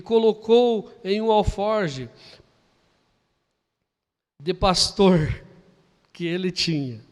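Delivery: loud, slow proclamation of the verse; sermon scripture read, loud and deliberate; dramatic scripture reading. Acted. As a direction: declaim the Bible passage. Reads scripture aloud.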